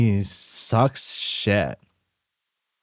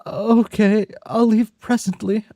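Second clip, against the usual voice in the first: high, polite urchin voice; Oliver Twist voice; 12 semitones higher than usual. Acted. A friend agreeing on the phone to leave, in a high orphan voice. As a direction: answer like the timid orphan. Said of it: "I'm doing the wrong voice".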